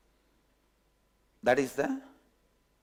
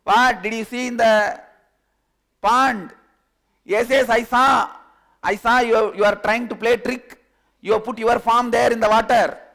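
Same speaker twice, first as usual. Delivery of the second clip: loud, raised voice, pitched higher; heated argument; 7 semitones higher, 11 dB louder than usual. Acted. Two quarrel over the reflection in the pond, loud. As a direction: argue hard, not backing down.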